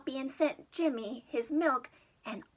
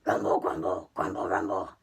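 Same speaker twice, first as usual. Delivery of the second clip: very low, loud rumbling beast voice; monster grumble; 5 dB louder, faster than usual. Acted. Storyteller voicing the hungry monster's deep rumble. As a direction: rumble it out like a big-bellied beast. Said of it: "roared and growled".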